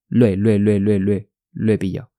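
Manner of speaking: "Lo he" run together as one syllable; low, drilled "lo he" glide; linked sounds. Shown as a demonstration. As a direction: rapidly repeat "lo he" with the vowels joined. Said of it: "要连读".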